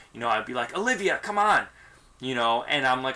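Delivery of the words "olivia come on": snippy. Retold or imitated. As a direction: hurry her up, short on patience. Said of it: "my tone will be a little bit snippy".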